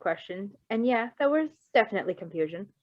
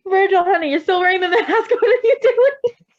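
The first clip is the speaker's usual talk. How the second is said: high and loud through laughter; giggling high-pitched teasing; laughing teasing; 10 semitones higher, 9 dB louder than usual. Real, not acted. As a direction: tease him while cracking up.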